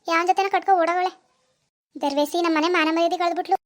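ordinary talk

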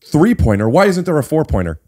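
mock objection. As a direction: mimic a petty rules-lawyer. Mocking a naive rules objector.

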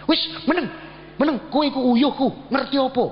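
mock-angry old father telling his son to shut up. Father scolding his preaching son, imitated angry rebuke. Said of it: "marah, langsung gini".

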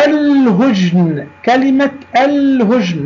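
الهجن with a held l before h; careful teacher demo, stretch the l in الهجن; moon lam. Shown as a demonstration. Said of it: "لام قمرية". (نطق «الهجن» بلام ساكنة واضحة)

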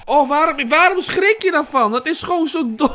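high, loud flustered complaint; protest, still shaken from the jump; rattled protest. Real, not acted. Player asks why they got startled, rattled protest. Just jumped, scolds self.

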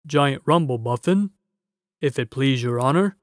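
a big humble giant answering; polite giant. A narrator's reading. Giant voice in story.

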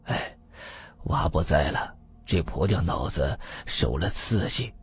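subdued and slow, with a sigh; soft, low, weary explanation; forced good cheer. A narrator's reading. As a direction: put on politeness over quiet grief. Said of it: "村长陪着笑脸说".

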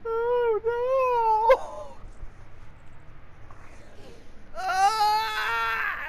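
high wheezing laugh into wailed "oh no"; helpless laughter. Real, not acted. Gamer who just lost, high helpless wailing. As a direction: squeal and wail while laughing.

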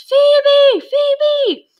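put-on high, loud yelling child's call; child's scream. A narrator's reading. Small boy on his uncle's shoulders screaming for Phoebe, loud and high. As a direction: scream her name high and loud across the crowd. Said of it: "the boy with the foghorn voice".

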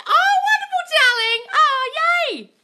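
very high, loud squealing; high-pitched, thrilled congratulation; gushing delight. Real, not acted. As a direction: squeal happily at their praise. Gushing at a viewer's thanks.

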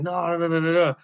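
high, rapid-fire string of noes; imitated pleading, voice lifted high; protesting denial.

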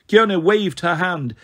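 ordinary delivery

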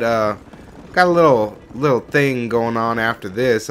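ordinary delivery

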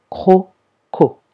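"Croco" split into two loud, distinct beats; syllables separated. Shown as a demonstration. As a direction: sound out "Croco" as CRO, pause, CO, both O's clear. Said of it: "on l'entend bien deux fois".

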